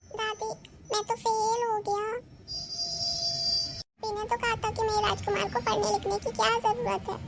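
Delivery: put-on high childish boy voice, sheepish; a pampered grandson owning up he failed; spoilt boy's voice. Grandson voiced, admits failing. (ऊँची बच्चे जैसी आवाज़ में)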